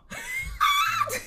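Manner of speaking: high shrieking laugh. Real, not acted. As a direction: burst into a squealing laugh. Big laugh after joke.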